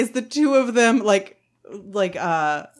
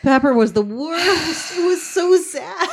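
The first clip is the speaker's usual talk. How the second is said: teary; 7 semitones higher than usual. Real, not acted. Mourning a sad movie scene.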